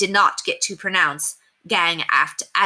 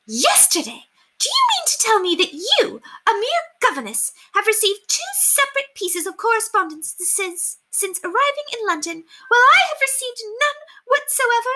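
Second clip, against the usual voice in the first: indignant rage. Acted. Jealous lady rages at the governess over letters. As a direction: pile on the indignation.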